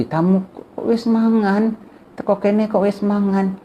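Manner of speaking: high, drawn-out Javanese reproach; mock annoyed host. Host chiding a guest who already ate, high mock annoyance. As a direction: imitate a put-out host, pitch well up. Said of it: "jadi marah dia begitu".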